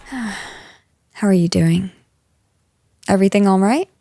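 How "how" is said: long tired sigh, whispered into the word How; heavy sigh. Acted. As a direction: sigh before a hard conversation. Weary boss before hard talk.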